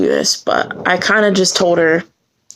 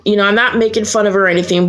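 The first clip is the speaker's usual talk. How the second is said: ordinary delivery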